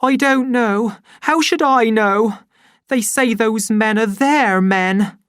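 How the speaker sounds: high, tearful, exasperated; upset, near tears. Acted. Linda answers her son before bursting into tears. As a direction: answer on the edge of crying. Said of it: "And she burst into tears".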